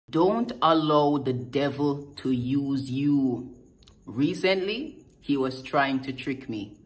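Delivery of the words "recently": usual speaking voice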